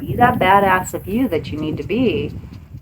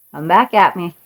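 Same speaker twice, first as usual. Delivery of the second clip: ordinary talk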